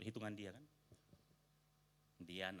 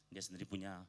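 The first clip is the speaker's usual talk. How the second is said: usual speaking voice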